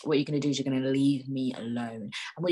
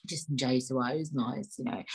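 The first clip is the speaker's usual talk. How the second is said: normal talking voice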